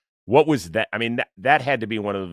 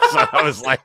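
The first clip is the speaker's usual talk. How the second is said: laughing; 6 semitones higher, 6 dB louder than usual. Real, not acted. Cracking up after own impression.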